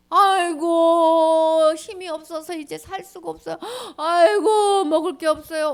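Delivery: loud, drawn-out crying aigo; wailing lament. Acted. Starving people crying to God, wailing. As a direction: wail in hunger.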